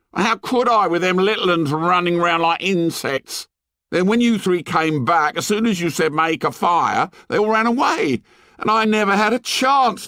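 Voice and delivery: put-on high-pitched boy defending himself; indignant protest. A narrator's reading. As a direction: protest your innocence, high and aggrieved. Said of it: "cried Piggy indignantly".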